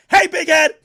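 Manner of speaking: shouting. Retold or imitated. Meek's text read out as an all-caps shout. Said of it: "In all caps, like he's shouting".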